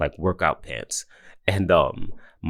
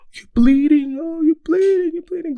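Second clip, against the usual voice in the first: much higher, loud gasping alarm; panicked daughter; 8 dB louder than usual. Retold or imitated. Daughter spotting dad's blood, imitated in a high, loud panic. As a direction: imitate a frantic little girl. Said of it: "She's gonna be like".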